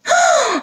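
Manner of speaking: high-pitched gasp of surprise; sudden startled high exclamation; surprised oh. A narrator's reading. Narrator gasps as chest opens.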